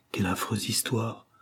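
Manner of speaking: usual speaking voice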